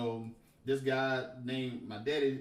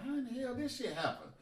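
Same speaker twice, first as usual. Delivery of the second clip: amazed disbelief; 11 semitones higher than usual. Retold or imitated. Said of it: "I was like".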